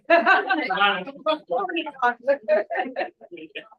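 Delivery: short amused laugh; warm laugh. Real, not acted. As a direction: burst out laughing. Laughing at meeting.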